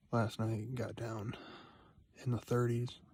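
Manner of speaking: ordinary delivery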